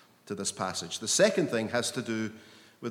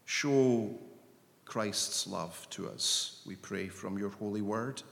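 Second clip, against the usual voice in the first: earnest prayer. Acted. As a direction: pray it, longing and unhurried. Leads congregation in prayer.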